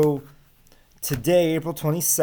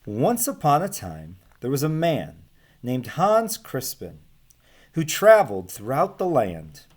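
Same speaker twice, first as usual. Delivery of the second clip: storybook reading voice for the class; story narration; 4 semitones higher than usual. Acted. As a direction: read it out like a picture book to children. Teacher reads picture book aloud.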